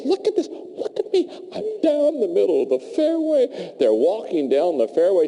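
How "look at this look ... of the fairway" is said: thrilled. Acted. Bad golfer thrilled by shot.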